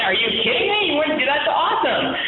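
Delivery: thrilled high exclamations with a laugh breaking in; excited disbelief. Retold or imitated. Retelling his reaction to her churchgoing news, thrilled high exclamations. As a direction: pitch it way up, delighted and laughing.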